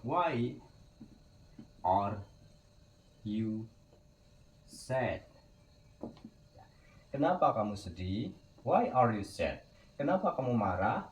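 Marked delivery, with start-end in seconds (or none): 0.0-5.2 each word set apart with pauses; word by word, for learners; slow word-by-word model. Shown as a demonstration. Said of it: "teacher models reversed question word by word". 8.6-9.6 slowed, clear model question; slow teacher model. Shown as a demonstration. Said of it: "teacher says full question".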